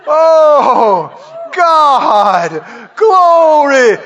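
long, swelling gasps of wonder, acted out; awestruck exclamations. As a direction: act out being overwhelmed by glory. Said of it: "you and I will be in glory with God going".

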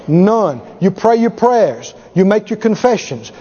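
ordinary delivery

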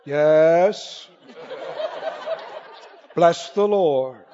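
a hint of pompous churchgoer mocking heaven's boredom; mock solemn saint. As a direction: parody stuffy worship, lightly. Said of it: "solemnly look at each other ... and go".

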